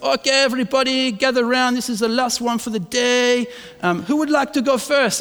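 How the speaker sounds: lightly hammed host gathering the riders; French ride operator. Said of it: "there was this French guy running the ride".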